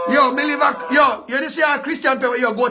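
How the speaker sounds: loud, high shout-out to the area. Real, not acted. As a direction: call it out to the whole block. Shouting out his neighbourhood.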